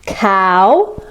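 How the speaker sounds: usual speaking voice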